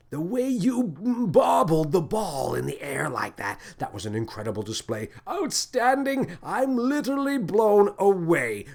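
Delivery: high, breathless rising amazement; amazed gasp. A narrator's reading. Captain marvels at Lily's ball skills, high amazed gushing. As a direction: gush with wide-eyed wonder. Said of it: "the captain gasped".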